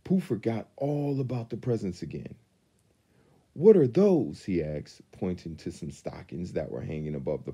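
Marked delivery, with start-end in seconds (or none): 3.5-4.4 excited question. A narrator's reading. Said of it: "In his excitement".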